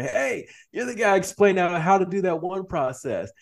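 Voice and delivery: a touch of delighted surprise, voice lifted high; excited recognition. Acted. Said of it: "with your grandkids and say".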